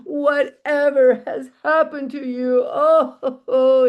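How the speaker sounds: high, loud mock wail of a grieving wife; hammed-up tearful wailing; wailing and weeping. A narrator's reading. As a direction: lament dramatically, drawing out the cries. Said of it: "and she's crying".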